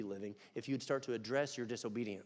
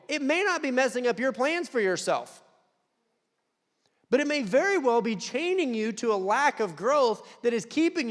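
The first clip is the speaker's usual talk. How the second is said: higher and louder preaching voice; fervent preaching; 9 semitones higher, 8 dB louder than usual. Real, not acted. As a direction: drive it into the congregation. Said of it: "preaching at full force".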